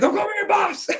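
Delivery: high-pitched mock irritation; retold annoyed squawk. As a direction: act out a fed-up protest, voice shooting up. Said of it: "He's just getting all annoyed".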